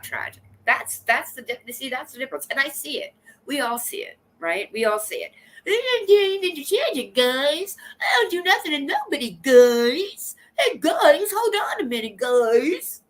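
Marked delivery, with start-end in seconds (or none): 5.7-13.0 high, loud whine with rising "guys"; Bot impression. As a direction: mimic Bot in a high, pushed voice, lifting every "guys". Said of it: "That's my bot impression".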